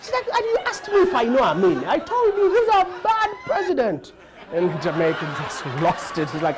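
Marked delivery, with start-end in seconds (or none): 0.4-4.1 indignant. Retold or imitated. Lady answers indignantly.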